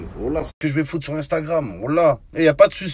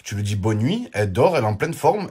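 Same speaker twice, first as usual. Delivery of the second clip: ordinary delivery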